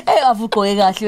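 normal talking voice

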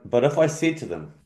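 ordinary delivery

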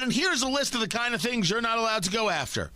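lightly voiced old politician laying down rules; Joe Biden. President handing Russia a list, Joe Biden impression. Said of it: "how's my Joe Biden impression".

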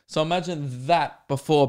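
ordinary talk

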